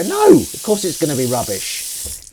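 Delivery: a hint of your wiser self scoffing; imagined self. Imagining second thoughts, slow scoffing. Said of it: "you go".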